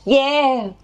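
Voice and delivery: high twangy call; twangy vocal warm-up; twang. Shown as a demonstration. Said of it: "we're gonna twang".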